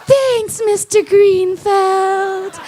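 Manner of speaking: high, slow ditzy starlet voice; breathy porn actress. Retold or imitated. Comic telling a porn scene, voicing the actress in high ditzy tone.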